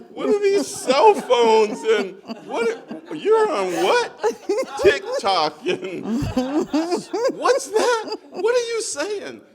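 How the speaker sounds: loud, high, drawn-out mock bewilderment; bewildered outburst. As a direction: act out utter bafflement, shouting in a high voice. Acts out bewilderment at new technology.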